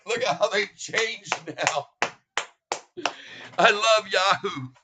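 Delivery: mock the headline while still laughing; laughing while talking. Real, not acted.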